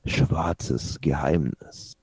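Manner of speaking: slow "Schwarzes Geheimnis" right down into a low, careful German; German pronunciation. Shown as a demonstration. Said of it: "say it like that".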